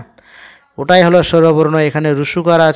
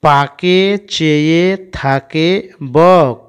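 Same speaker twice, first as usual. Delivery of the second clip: word by word, very drawn out; slow model reading; much slower than usual. Shown as a demonstration. Teacher reads the sentence to pupils, slow and drawn out. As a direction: stretch each word for beginners.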